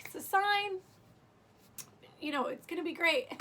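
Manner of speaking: high, emotional voice, imitating being moved; retold choked-up. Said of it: "I got all choked up".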